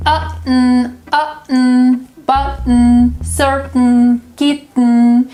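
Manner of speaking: "Uh-n", "Button, certain, kitten" stopped, then a held n; glottal stop drill. Shown as a demonstration. Drilling learners on adding the n, glottal stop. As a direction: catch the throat, then hum out the n. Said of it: "you can practice it adding the sound".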